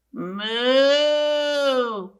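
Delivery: long, rounded cow moo; big storybook moo; drawn-out moo. Acted. Puppy George makes cow noise.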